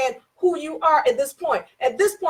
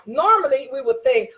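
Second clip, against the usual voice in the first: normal talking voice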